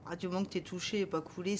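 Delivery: usual speaking voice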